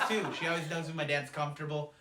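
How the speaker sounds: ordinary delivery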